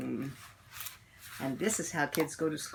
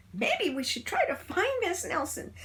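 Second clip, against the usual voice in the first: put-on high child voice; hopeful kid; 13 semitones higher than usual. A narrator's reading. Child proposing to find the teacher, high kid voice. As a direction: suggest the plan like a child.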